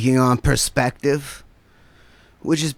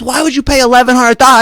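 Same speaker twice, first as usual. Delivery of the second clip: high, loud, rushed mock outrage; indignant questioning; 9 semitones higher, 5 dB louder, faster than usual. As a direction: imitate a friend demanding an explanation. Retold quarrel over the money.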